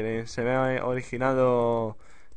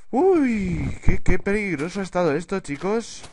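loud, high outburst after danger; relieved exclamation; 5 semitones higher, 6 dB louder than usual. Real, not acted. Player safe home after danger, relieved exclamation.

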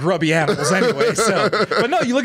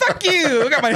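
high, hammed-up defiance; Davy Crockett telling Mexican soldiers off, hammed up; mock defiant Crockett; 9 semitones higher than usual. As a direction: sneer it out like a cocky frontiersman. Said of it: "That's how he sounded".

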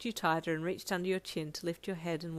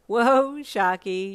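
playful exclamation; 3 semitones higher, 11 dB louder, much slower than usual. A narrator's reading. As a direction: pull back with amused surprise. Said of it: "you giggled".

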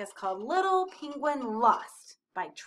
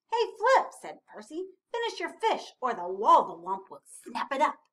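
raised storybook character voice; calling out; 7 dB louder than usual. A narrator's reading. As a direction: call brightly to get attention. Percy calls to Flip in story.

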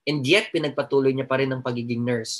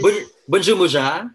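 mock French; 7 dB louder than usual.

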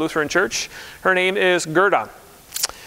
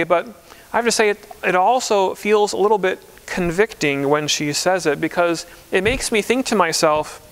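ordinary talk, nothing marked

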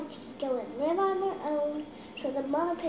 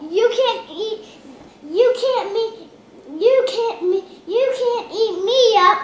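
loud defiant; 7 semitones higher, 12 dB louder than usual. Acted. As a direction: stand up to the troll. Big goat defies troll.

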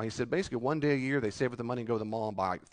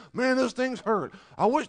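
mock complainer; 10 semitones higher, 5 dB louder than usual. Voicing a complaining parent.

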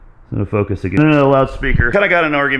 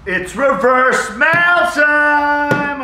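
drawn-out high shouted announcement; announcer shout; 7 dB louder, much slower than usual. Acted. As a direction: hype it like a show intro. Announcing segment title.

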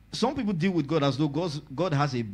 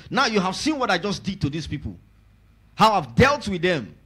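raised, ringing sermon voice; preaching; 4 semitones higher, 4 dB louder than usual. Acted. Pastor proclaiming God's promise to the congregation, preaching voice.

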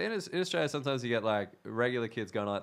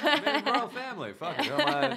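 laughing; 13 semitones higher than usual. Real, not acted. Laughing mock defence of marriage.

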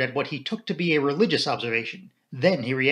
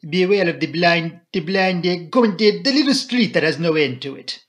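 put-on higher, louder, excited French accent; Poirot, very foreign; 4 semitones higher, 7 dB louder than usual. A narrator's reading. Poirot warns the inspector, excitedly foreign. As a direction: grope for English words in a heavy French accent. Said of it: "Poirot became suddenly very foreign in manner".

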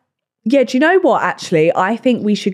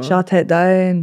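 slow, drawn-out put-on accent; mock London accent; much slower than usual. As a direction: stretch it out in a borrowed accent. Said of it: "That was meant to be yours".

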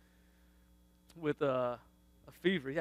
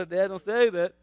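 put-on kid's loud scolding; 3 semitones higher, 9 dB louder than usual.

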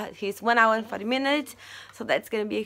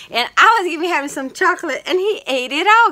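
louder and higher, thrilled telling; excited; 6 semitones higher, 8 dB louder than usual. Real, not acted. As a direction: share the news, bursting with excitement. Excited report about chocolate.

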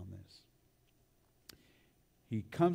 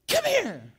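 very high, loud imitation of a scolding aunt; sharp voice; 8 dB louder than usual. Aunt catches grandson a second time, sharp summons. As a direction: summon a disobedient child sharply. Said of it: "that sharp voice".

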